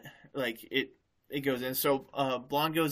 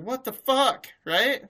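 much higher and louder, a shocked yell; shocked hairstylist; 10 semitones higher, 7 dB louder, slower than usual. Retold or imitated. Hairstylist finds the customer dead, shocked yell. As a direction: shriek it as the stunned stylist. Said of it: "hairstylist is like".

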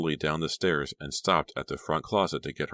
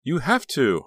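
high, loud demand; pushy, high-pitched coaxing; insistent; 10 semitones higher, 6 dB louder, slower than usual. A narrator's reading. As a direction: insist with eager pressure. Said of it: "Honey insisted".

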